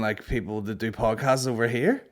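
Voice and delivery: ordinary talk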